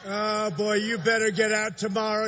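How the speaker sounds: ordinary talk, nothing marked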